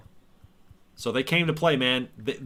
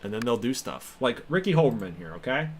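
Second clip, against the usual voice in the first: ordinary talk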